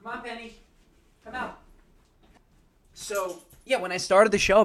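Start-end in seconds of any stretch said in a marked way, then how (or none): 0.0-1.6 high, soft coaxing call; coaxing a pet. Real, not acted. Coaxing Penny off the couch.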